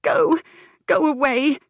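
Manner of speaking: high, loud, tearful cry; wife sending her husband away, high and tearful; sobbing plea. Acted. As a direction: push him away through tears. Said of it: "She broke off on a sob".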